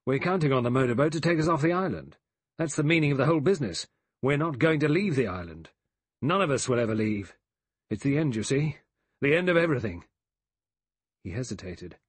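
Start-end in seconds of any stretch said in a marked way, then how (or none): 0.1-10.1 higher-pitched, fast, sharp insistence. A narrator's reading. General tells the men they will never leave the island, sharply. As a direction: say it sharply, pressing on urgently. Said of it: "General MacArthur said sharply".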